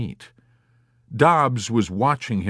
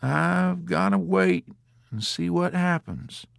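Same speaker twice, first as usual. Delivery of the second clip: high, drawn-out stubbornness; stubborn; 9 semitones higher than usual. A narrator's reading. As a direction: dig in, stretching the words. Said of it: "Dobbs maintained stubbornly".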